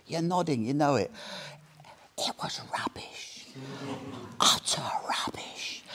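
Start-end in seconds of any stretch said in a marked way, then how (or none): 2.2-5.8 slow, soft stage whisper; whispered verdict. Real, not acted. Speaker confiding the book was rubbish, whispered. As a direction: whisper it slowly, word by word.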